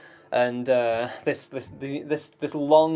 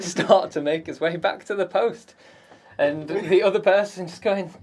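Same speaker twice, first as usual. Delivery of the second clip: normal talking voice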